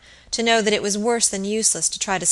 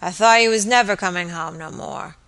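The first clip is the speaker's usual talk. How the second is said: put-on drunken voice; 3 dB louder than usual. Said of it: "He spoke in a thick and drunken voice".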